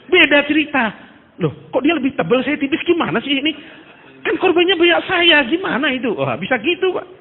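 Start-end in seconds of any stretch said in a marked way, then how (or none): 1.4-6.1 higher, louder whining protest, climbing at the end; mock indignant complaint. Preacher grumbling about his thin envelope, mock indignant whine. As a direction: play a jealous preacher grumbling over a thinner envelope.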